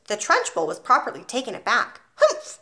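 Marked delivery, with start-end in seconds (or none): 2.2-2.6 a sharp, high "Humph!" snort of disbelief. A narrator's reading. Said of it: "she snorted".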